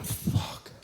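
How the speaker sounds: hushed, voiceless tearful curse. Retold or imitated. Comedian crying in the cinema after the film, tearful. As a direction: act stunned to tears, barely any voice. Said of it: "sitting in the cinema crying and going".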